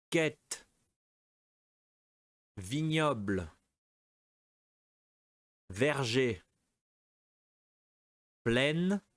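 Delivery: each word spaced and clearly said for the class; slow, careful teacher model for repeating; model pronunciation. Shown as a demonstration. Teacher giving words for students to repeat aloud. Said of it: "Donc, répétez".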